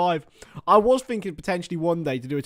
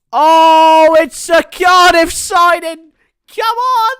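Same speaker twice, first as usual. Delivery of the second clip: high, loud, slowed shout of joy at the news; excited shout; 11 semitones higher, 11 dB louder, much slower than usual. Real, not acted. Streamer shouting to his viewers about a Cardiff signing, overjoyed. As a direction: yell it out, thrilled by the signing.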